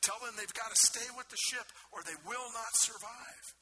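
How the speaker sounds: high, loud shouted warning; preacher voicing Paul shouting to the crew; yelling over storm. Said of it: "Paul yells out to them".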